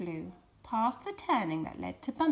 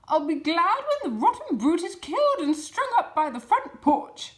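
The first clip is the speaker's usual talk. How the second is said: loud, rough-voiced woman calling up; big woman calling out; 7 semitones higher, 8 dB louder than usual. A narrator's reading. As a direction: bellow it with relish. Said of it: "she called out".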